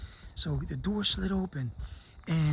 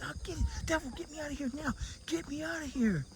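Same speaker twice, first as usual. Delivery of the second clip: high pleading; 9 semitones higher, 5 dB quieter than usual. Retold or imitated. Begging the devil.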